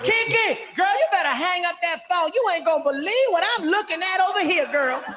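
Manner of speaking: put-on high, fast, sassy phone call to a girlfriend. Hotel delivery woman phoning her friend, sassy and high.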